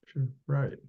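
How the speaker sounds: ordinary talk, nothing marked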